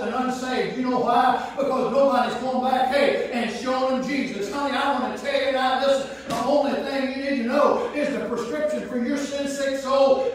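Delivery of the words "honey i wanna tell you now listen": ordinary delivery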